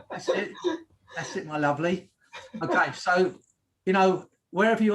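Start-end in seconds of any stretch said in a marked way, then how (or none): 0.1-2.1 tentative rural Suffolk accent; attempted Suffolk accent. Shown as a demonstration. Host teasing about where Helen lives, attempted Suffolk accent. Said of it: "try and do a Suffolk accent".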